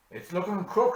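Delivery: loud, high grumbling farmer; gloomy farmer. Acted. Daniel Croke grumbling to the congregation, loud gloomy voice. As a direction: complain of the bad season. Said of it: "said Daniel Croke".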